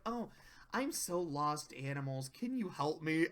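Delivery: soft, low little plea; small, quiet lost voice; timid Fluttershy. Retold or imitated. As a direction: ask meekly for help. Lost Fluttershy pleads with animals.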